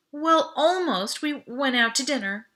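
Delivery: high, halting, caught-out answer; sheepish evasion. Acted. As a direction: stretch it out, stalling for time. Caught, guilty evasion to dad.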